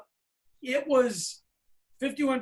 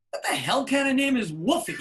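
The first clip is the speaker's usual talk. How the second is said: louder, higher disbelieving yell; incredulous outburst; 4 semitones higher, 5 dB louder than usual. Retold or imitated. Boyd baffled by the kid's name, loud disbelief. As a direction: blurt it out in disbelief.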